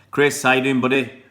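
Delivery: hearty shout-out; loud greeting. Real, not acted. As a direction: call out to Chris in chat loudly. Greeting a viewer loudly.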